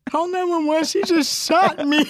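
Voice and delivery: high, slow laughing cry for help; laughing panic. Retold or imitated. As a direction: wail it while cracking up. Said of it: "she's like".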